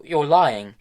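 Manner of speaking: high, loud outburst; loud accusation. A narrator's reading. Tom shouting at Hester that she lies, loud. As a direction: hurl it at her.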